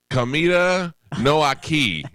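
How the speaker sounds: slow, high attempt at Spanish; halting high Spanish. Customer telling driver food is missing, slow high-pitched Spanish. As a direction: labour through foreign words for comic effect.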